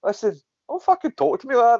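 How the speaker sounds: sharp, high, heated comeback; mock outrage at the staff; angry retort. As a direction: snap back at him, high and riled. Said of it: "I'm like".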